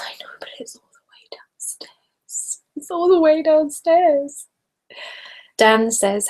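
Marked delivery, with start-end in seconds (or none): 0.0-2.6 very soft, voiceless complaint with a laugh; whispered laughing grumble; hushed whisper. Real, not acted. Protesting the trip for wine. 2.8-4.5 high, slow playful whine; drawn-out, hammed-up complaint; mock whine. Repeats the protest out loud, playfully.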